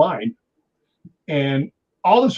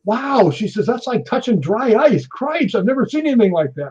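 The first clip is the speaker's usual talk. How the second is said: astonished woman; 8 semitones higher than usual. Retold or imitated. Fellow investigator touching his icy arm, imitated amazement. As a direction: mimic her stunned gasp of wonder. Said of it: "and she goes".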